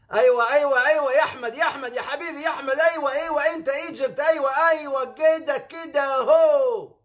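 loud, high Egyptian Arabic cheering; high loud Cairo street shouting. Retold or imitated. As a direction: call out like an excited Egyptian. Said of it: "The Egyptian way".

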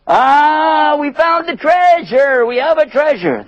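high excited yell; excited shout. Acted. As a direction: cry out the lucky find. Traveller excited at find.

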